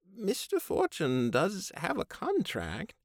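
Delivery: high, slow, apologetic; Babe voice. A narrator's reading. Babe sides with Fraser.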